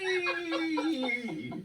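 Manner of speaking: long, very high, gleeful squeal; unintimidating battle cry. Acted. Host mocking Viking raiders on sleds, silly gleeful squeal. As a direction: squeal it like a kid on a slide. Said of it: "it wasn't very intimidating. Instead of a battle cry, they were going".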